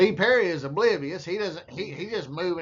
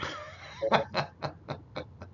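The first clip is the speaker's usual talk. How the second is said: high, breathy chuckling laugh; real laugh; 6 semitones higher, 6 dB quieter than usual. Real, not acted. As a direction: laugh softly in quick high bursts. Laughs at the picture.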